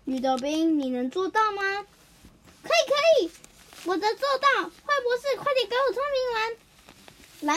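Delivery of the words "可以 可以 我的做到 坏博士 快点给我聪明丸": put-on impatient child pestering the doctor; 急切的小孩. 绿豆冰急着要药丸. (高音急切童声，最后拖长)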